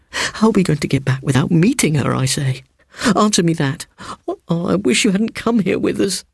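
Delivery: panicky, barely holding it together; terrified boy. A narrator's reading. Boy terrified, teeth chattering.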